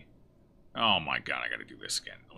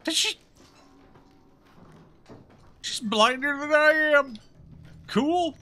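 high, loud gleeful surprise; excited disbelief; 13 semitones higher, 6 dB louder than usual. Real, not acted. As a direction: laugh it off with relief. Relieved at escaping unseen.

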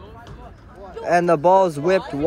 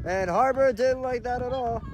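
usual speaking voice

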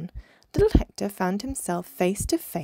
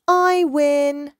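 loud, high victory call; triumphant cry; 7 semitones higher, 7 dB louder than usual. A narrator's reading. Young dolphin beats his cousin at wrestling, triumphant cry. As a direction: crow it out, drawn and high. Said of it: "he cried".

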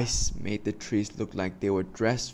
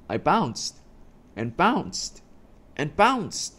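rising bounce; 7 semitones higher, slower than usual. Acted.

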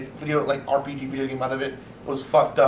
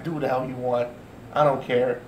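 ordinary delivery

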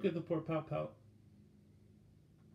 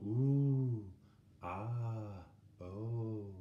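wonderstruck ooh; 3 semitones lower, much slower than usual. Shown as a demonstration. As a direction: breathe out wonder at something lovely. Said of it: "you see something and you go".

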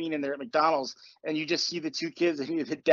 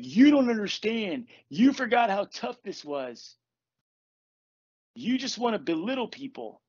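mock whining; 5 semitones higher than usual. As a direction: mimic the self-pitying complainer. Mocking a complaining member.

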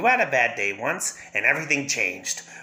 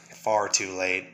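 ordinary delivery

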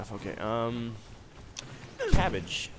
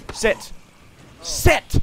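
high, loud yelled command; shouted command; 10 dB louder than usual. Real, not acted. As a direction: bark it at the horse. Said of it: "I wanted you to sit down".

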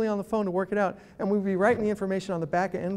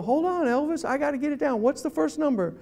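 stalling caller; 6 semitones higher than usual. Acted. Caller stalling agent Elvis to write it down, high-pitched. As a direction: play dumb, pitched up. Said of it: "Then you go".

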